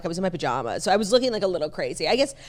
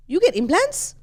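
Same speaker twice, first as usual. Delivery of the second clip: put-on a light Latin accent, pitched up; 9 semitones higher than usual. Lightly voiced Latin stylist asking about implants. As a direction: hairstylist in a Latin accent, higher. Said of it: "So I'm gonna do an accent".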